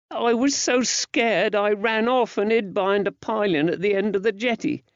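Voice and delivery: put-on high-pitched frightened boy; small boy confessing he ran away; scared young lad. A narrator's reading. Narrator voices young Georgie.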